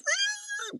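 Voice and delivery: very high-pitched imitated machine whine; machine squeal. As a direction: squeal like a printer cranking out clones. Imitates a cloning machine printing.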